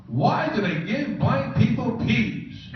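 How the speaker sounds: high, slow, put-upon whine about peas; mock her fussy complaint, drawn out; imitating a snooty complaint. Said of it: "she said this to Henry as a servant".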